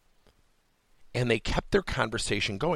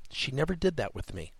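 ordinary talk

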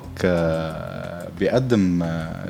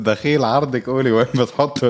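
a hint of a panicked father begging, slightly higher; pleading dad; 3 semitones higher than usual. Father begs his daughter not to tell, half-laughing mock plea.

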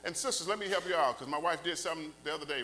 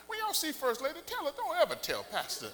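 ordinary talk, nothing marked

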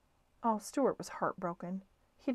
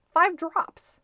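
high, louder little mouse; Stuart's voice; 10 semitones higher, 9 dB louder than usual. A narrator's reading. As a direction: state it high and firm. Stuart answers the attendant.

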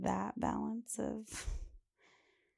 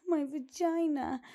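put-on slow, higher child voice repeating the word; insistent little girl; 7 semitones higher, 3 dB louder, slower than usual. Host imitates a little girl insisting to her mom, higher and slow. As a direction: say it again as a kid would, insisting.

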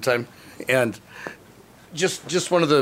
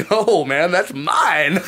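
higher and louder, proudly protesting; louder, beaming ownership; proud insistence; 5 semitones higher, 6 dB louder, much slower than usual. Retold or imitated. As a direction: defend your prize with a grin. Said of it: "I'm like".

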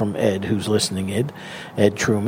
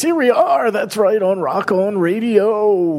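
put-on high, slow, booming old-time DJ; AM radio voice; 8 semitones higher, slower than usual. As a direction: croon it like a vintage AM radio host. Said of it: "Give me an A M voice".

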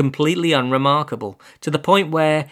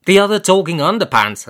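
peevish; 5 semitones higher, 6 dB louder than usual. A narrator's reading. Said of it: "I said rather peevishly".

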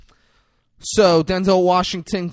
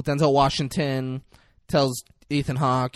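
usual speaking voice

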